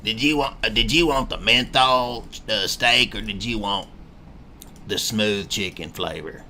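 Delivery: lightly played chain-smoking diner waitress; diner waitress cigarette voice. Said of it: "diner waitress cigarette voice too".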